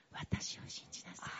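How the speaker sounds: hushed whisper. Retold or imitated. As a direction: whisper it softly. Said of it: "神様は言います".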